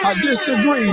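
insistent objection high in the voice; high-pitched protest. Real, not acted. Insisting to Nate against the title, high-pitched protest. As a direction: keep the disagreement way up high.

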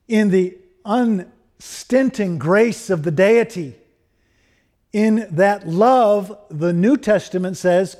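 measured, emphatic pulpit delivery; earnest preaching. Real, not acted. Preacher asks congregation if they are grounded in God, emphatic preaching. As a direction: preach it firmly, leaning on the key words.

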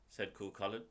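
usual speaking voice

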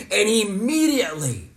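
immediately called out high and loud; loud emphatic immediately. Shown as a demonstration. Dad cueing the kids to repeat, immediately called out loud. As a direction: ring out the word immediately. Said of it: "say immediately".

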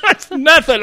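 ordinary talk, nothing marked

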